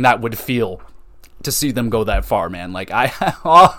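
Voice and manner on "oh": ordinary delivery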